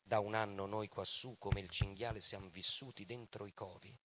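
ordinary talk, nothing marked

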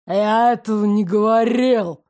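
a hint of an angry growl. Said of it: "прорычал Ирик".

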